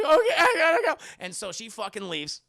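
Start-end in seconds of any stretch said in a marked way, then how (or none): 0.0-1.0 loud, high, half-crying squeal; hammed-up whimpering panic; panicked exit. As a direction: blurt it while fleeing. Friend fleeing in panic.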